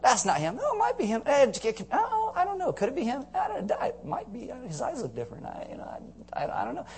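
high, soft dithering voice; confused neighbors. Retold or imitated. Neighbors puzzling over the healed man, high and dithering. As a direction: waffle back and forth, unsure. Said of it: "So they're like".

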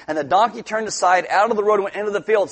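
ordinary talk, nothing marked

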